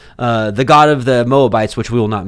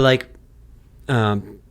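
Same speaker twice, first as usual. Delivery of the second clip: ordinary talk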